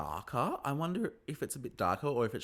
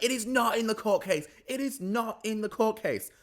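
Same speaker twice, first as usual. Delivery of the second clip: high, insistent mock yell on each word; imitated yelling denial; 13 semitones higher, 4 dB louder than usual. Recapper imitating a housewife denying the claim, mock yelling. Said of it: "she's yelling".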